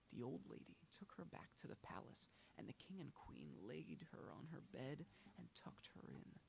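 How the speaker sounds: ordinary delivery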